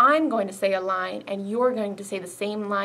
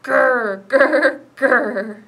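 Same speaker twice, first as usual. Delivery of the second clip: louder playful growls; bear growl; 9 dB louder, much slower than usual. Retold or imitated. Librarian growling like a bear for the kids. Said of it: "A bear is what I found".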